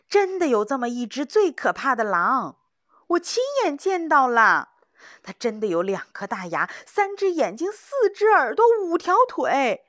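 loud, high, panicky rush of words; frantic insistence. Acted. Young hunter frantically insisting to the old hunter, loud and high. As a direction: blurt it out, desperate to be believed. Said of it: "年轻的猎人急了，他抢着说".

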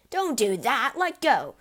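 loud, high panicked yell; frantic pained shouting. A narrator's reading. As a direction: shout at her to stop pulling. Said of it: "let out a yell".

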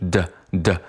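T softened into a slow, voiced D; D sound. Shown as a demonstration. Teacher isolates the consonant for students, voiced D sound. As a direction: say D, D in place of the T. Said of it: "它发的不是T，而是".

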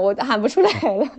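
ordinary delivery

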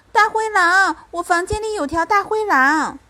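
loud, high-pitched cry of alarm; urgent, high and loud; child's alarm. A narrator's reading. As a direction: raise the alarm like a frightened child. Child alerts mother to wolf.

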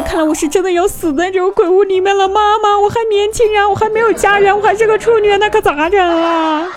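high, loud desperate wail; panicked wailing. Retold or imitated. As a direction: cry out to mom in terror. Panicking, thinks she will die.

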